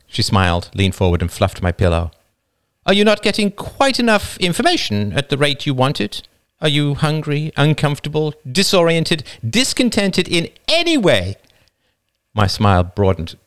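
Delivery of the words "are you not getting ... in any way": higher wife's voice, mock-solicitous; the wife, gently sarcastic; teasing wife. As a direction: list his comforts with fond irony. Wife teasing her husband.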